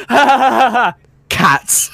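high loud cackling laugh; imitated laughter. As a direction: mimic a giddy driver laughing. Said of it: "he's just laughing his ass off".

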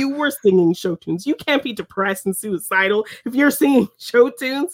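mock disbelief, pitch climbing; retold accusation breaking into amusement; incredulous accusation. Retelling Cooper challenging the fake depressed rock star, incredulous.

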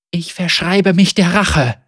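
a shaky, loud oath; trembling voice. Acted. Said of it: "mit bebender Stimme".

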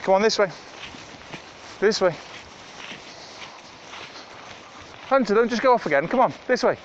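loud, high call across the field; calling the dogs. Real, not acted. Owner calling her dogs back on a walk, loud high call. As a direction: shout them back to heel.

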